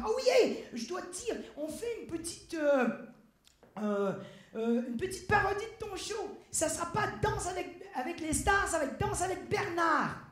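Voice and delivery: high, eager mock-journalist voice; excited pitch. Journalist pitching a parody to the dancer, excited. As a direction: pitch it with bubbling enthusiasm.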